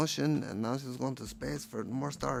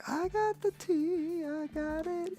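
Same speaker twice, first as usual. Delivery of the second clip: much higher, slow, singsong mermaid; slower than usual. Retold or imitated. Voicing the mermaid proudly holding the tea. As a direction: chirp it up high and proud. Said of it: "she's like".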